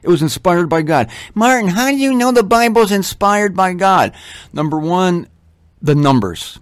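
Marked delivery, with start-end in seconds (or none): 1.4-4.1 higher, skeptical asking voice; mock questioner. Preacher voicing a doubter questioning him, higher.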